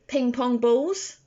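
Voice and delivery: ordinary delivery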